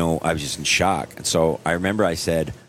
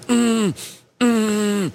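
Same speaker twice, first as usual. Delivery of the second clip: pained groan. Retold or imitated. Sudden knee pain.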